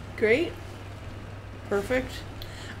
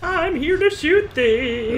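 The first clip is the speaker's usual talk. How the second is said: Rex voice; 7 semitones higher, slower than usual. Retold or imitated. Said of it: "Rex's voice".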